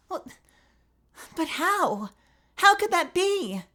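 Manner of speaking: high but too hard; lacking softness; not soft enough. Acted. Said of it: "where's my softness?".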